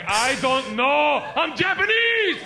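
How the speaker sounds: high, loud, slow mock outrage; exasperated yell. Stranger answering the pestering traveler, exasperated drawn-out yell. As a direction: yell it slowly in exaggerated exasperation.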